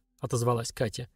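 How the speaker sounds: plain reading voice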